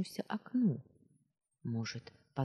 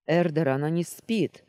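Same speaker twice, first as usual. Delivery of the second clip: loud, alarmed call; raised-voice exclamation; exclaiming; 2 semitones higher, 7 dB louder than usual. A narrator's reading. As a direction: call out a warning to Эрдер. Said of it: "воскликнул кто-то знакомым голосом".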